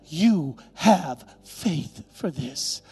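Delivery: very slow, word by word; emphatic divine pronouncement. Retold or imitated. God rewarding the pastor's faithfulness, slow emphatic pronouncement. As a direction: land each word separately with weight. God's word repeated with weight.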